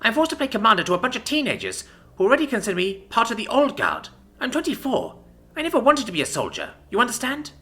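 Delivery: bitter rage. A narrator's reading. Enraged commander's rant.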